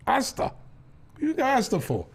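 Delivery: high-pitched disbelieving question to his son; put-upon dad querying an odd request; incredulous dad. Retold or imitated.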